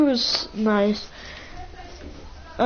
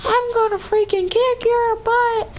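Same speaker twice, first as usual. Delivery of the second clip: much higher, squeaky mock threat from a little girl; hammed-up high kid voice making a threat; 12 semitones higher than usual. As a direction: imitate a second grader threatening, pitched way up. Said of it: "she was like".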